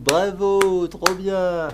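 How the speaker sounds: «Bravo» lancé plus aigu et plus fort, faussement fier; s'applaudir soi-même en rigolant; auto-félicitation moqueuse. Pretend. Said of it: "applaudissez-moi". (se féliciter devant le chat, fausse fierté)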